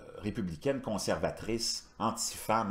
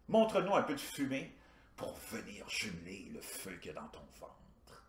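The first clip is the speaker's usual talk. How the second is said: hushed comic aside; 6 dB quieter than usual. Acted. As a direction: drop right down and savour it darkly. Mock incantation inside the stand-up routine.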